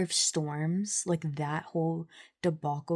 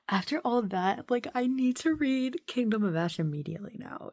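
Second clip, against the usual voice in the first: ordinary talk, nothing marked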